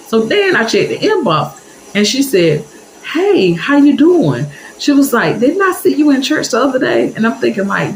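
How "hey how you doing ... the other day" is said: high, sugary parting call; sweet church lady. Retold or imitated. The church lady calls to the teller as she leaves, high and sweet. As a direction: wave her off sweetly.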